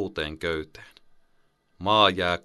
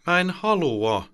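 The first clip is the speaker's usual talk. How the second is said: put-on high, slow, louder child's protest; a bit louder; 11 semitones higher, 4 dB louder than usual. Boy carried from bed protests to his mother, louder. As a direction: push back, raising the voice. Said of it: "hän sanoo vähän isommalla äänellä".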